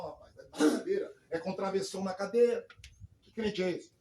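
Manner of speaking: loud mock disbelief from a fellow prisoner; mocking retort. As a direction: play the taunting inmate. Retold jab at the pastor.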